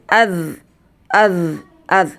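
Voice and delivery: az ending in a held z that does not bounce; az drill. Shown as a demonstration. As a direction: end az on a steady buzz. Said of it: "Tidak boleh mantul ya".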